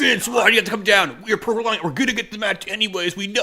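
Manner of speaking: high-pitched mocking complaint; mock frustrated fan. Podcaster parrots his co-host's complaint to the boss, mock frustration. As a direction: whine it up high, imitating his griping. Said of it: "You're frustrated".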